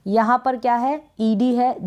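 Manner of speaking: ordinary talk, nothing marked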